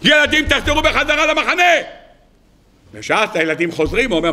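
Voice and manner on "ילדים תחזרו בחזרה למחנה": high, sustained calling-out; acted yell. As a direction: summon children back with a mock shout. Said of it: "מה הוא צריך לצעוק". (קריאה מעושה בקול גבוה)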